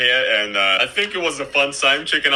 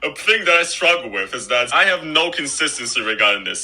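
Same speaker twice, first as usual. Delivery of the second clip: ordinary talk, nothing marked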